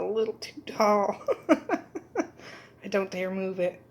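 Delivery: laughing through the words. Real, not acted. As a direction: giggle at the overfull mug. Laughing over too-full mug.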